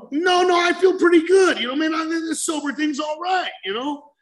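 high, loud, breezy no-thanks; loud cocky refusal. Retold or imitated. Turning down a joint from friends, loud and breezy. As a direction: brush off the offer with a loud, proud grin.

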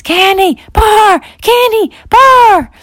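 loud, high desperate calls; calling out. Acted. Parents calling for lost kids outside, desperate. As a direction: shout the names across the yard. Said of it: "started calling their kids".

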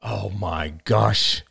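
slow, drawn-out realisation; slow gasp of recognition; dawning shock. A narrator's reading. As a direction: stretch it out as it dawns on you. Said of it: "he cried".

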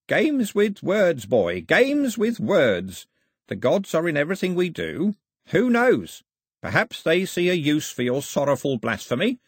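put-on high, drawn-out old man's reproof; chiding old priest. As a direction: chide the boy with lofty, stretched words. Old priest chides the boy.

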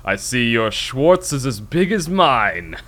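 slow, loud, drawn-out movie-villain voice; movie villain taunt. Acted. Villain taunting Lone Star in a saber duel, slow loud gloating. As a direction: gloat slowly and loudly at the hero. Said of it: "The guy's like".

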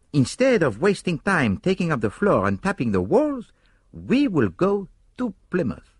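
put-on high, accented detective's voice; confident Poirot. A narrator's reading. Poirot explains the hiding place to Hastings, high and confident.

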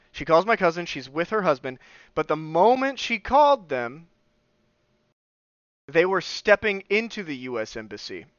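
ordinary talk

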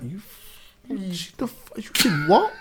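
stunned, high-pitched "you did what"; shocked disbelief. Retold or imitated. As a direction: react like you can't believe it. Sometimes I'm kinda like.

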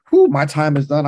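winded, worked-up preacher's sigh of release; fired-up exhale. Real, not acted. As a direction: blow out the heat of the moment. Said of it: "now I'm warmed up".

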